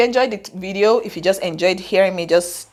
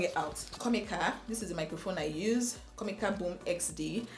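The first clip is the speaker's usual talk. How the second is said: much softer, off-mic aside; away from the mic; 16 dB quieter than usual. Real, not acted. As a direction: say it quietly while turned away from the mic. Moves off to fetch the microphone.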